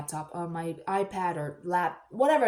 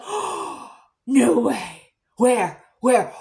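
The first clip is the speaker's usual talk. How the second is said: gasped, breathy "oh", then loud disbelief; retold excited gasp turning loud; freaking out; 2 semitones higher, 8 dB louder, much slower than usual. Acquaintance hears about baby pythons, gasping excitement. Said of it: "She's, like, freaking out".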